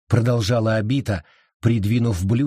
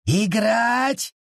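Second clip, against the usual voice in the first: outraged bark; barked outrage; 12 semitones higher than usual. A narrator's reading. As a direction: throw the word back angrily. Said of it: "рявкнул лес".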